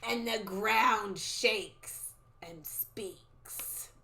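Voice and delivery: extremely slow, trailing prophecy; drawn-out, fading, ominous crone voice; old lady soothsayer. Acted. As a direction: intone it like a creepy seer. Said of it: "the old lady talking".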